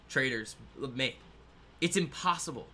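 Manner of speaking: ordinary talk, nothing marked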